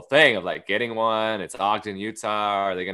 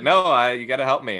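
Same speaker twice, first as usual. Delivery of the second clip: loud, raised-pitch retold plea; insistent plea; 5 semitones higher, 7 dB louder than usual. Stranded passenger to airline agent, loudly insistent. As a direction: act out pressing hard for help.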